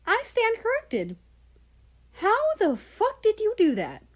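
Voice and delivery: amazed. Acted. Ghost amazed.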